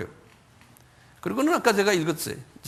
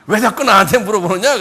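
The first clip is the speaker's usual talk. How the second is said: loud, higher acted protest; mock-annoyed raised voice; exasperated retort; 4 semitones higher, 9 dB louder than usual. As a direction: throw the question back in irritation. Healed man protests repeated questions.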